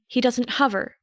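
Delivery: ordinary delivery, nothing marked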